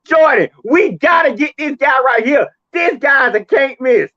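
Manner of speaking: high, loud, urgent pleading pitch to the boss; imagined yes man. Acted. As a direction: play an eager underling pushing his pick. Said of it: "one of the yes men should have said".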